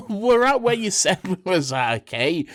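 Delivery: high, loud, indignant retort; comic exasperation. Real, not acted. Co-host bickering over the recap, loudly exasperated. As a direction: answer in an exaggerated huff.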